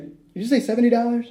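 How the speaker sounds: high-pitched, laughing double take; stunned disbelief. Retold or imitated. Customer to waiter over seventy dollars, stunned disbelief. Reacting to a shocking price.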